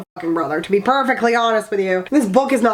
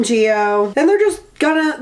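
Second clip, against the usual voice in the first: usual speaking voice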